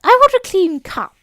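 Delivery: high, loud demanding cry; loud interruption. Acted. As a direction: cut in loudly, up high. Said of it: "interrupted the Hatter".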